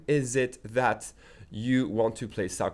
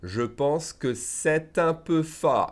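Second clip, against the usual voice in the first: slow, higher French, every syllable of "Je pense que" sounded; slow reading; 4 semitones higher, slower than usual. Shown as a demonstration. Teacher shows learners how it is read, slowly. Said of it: "I'm saying it slowly".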